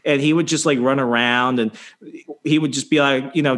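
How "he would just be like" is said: ordinary delivery, nothing marked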